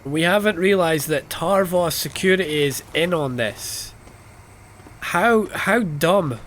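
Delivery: real exasperation at sheer stupidity; incredulous complaint. Real, not acted. Exasperated rant at the game's plot.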